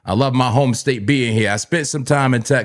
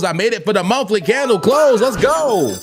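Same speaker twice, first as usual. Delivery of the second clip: excited; 8 semitones higher than usual. Real, not acted. Excited about candle close.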